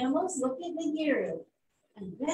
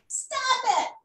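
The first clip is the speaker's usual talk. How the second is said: high squeaky little warthog cry; tiny warthog, squeaky and loud; 12 semitones higher, 4 dB louder than usual. A narrator's reading. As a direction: squeak it out to stop the fight. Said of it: "cries Nagiro Madogo in a squeaky voice".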